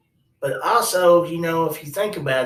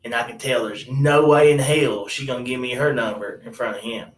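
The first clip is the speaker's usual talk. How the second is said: ordinary delivery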